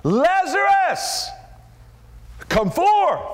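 high, loud, drawn-out call of Lazarus; loud cry. Acted. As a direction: call the dead man out of the tomb. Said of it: "he cried out with a loud voice".

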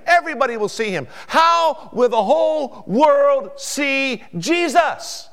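high, loud, soaring pulpit cry; full-force preaching. Real, not acted. Preacher proclaiming Christ's return to all, full-force preaching. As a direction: proclaim it, building to a stretched final shout.

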